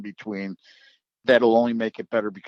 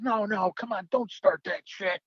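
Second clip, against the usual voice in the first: retold high-pitched objection; mock protest; 11 semitones higher than usual. Boss brushing off his announcer's pay complaint, high-pitched mock protest. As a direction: wave off the complaint in a much higher voice.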